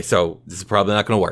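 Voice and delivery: ordinary talk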